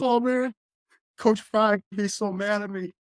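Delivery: high, whiny put-on voice of a teary friend; bawling friend. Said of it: "You could tell he was bawling".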